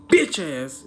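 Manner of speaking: ordinary talk, nothing marked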